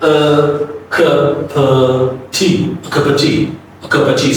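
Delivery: unvoiced "a cup of tea" practice; slow schwa demo. Shown as a demonstration. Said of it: "I want to see, like, in details".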